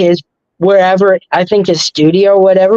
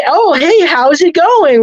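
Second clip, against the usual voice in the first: cheerful greeting; 9 semitones higher than usual. Retold or imitated. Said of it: "he was like".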